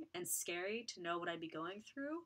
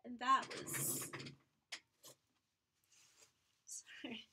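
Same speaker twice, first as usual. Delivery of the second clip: real tears, trailing to a whisper; getting emotional; 5 semitones higher, slower than usual. Real, not acted. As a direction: voice gives out into a whispered sorry. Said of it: "Now I'm getting emotional".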